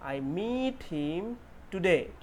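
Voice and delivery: "meet" with a long stretched ee. Shown as a demonstration. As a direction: drag out the ee of "meet" very slowly. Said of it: "जो मैंने जिस time पढ़ा". ("meet" में लंबा ई खींचकर)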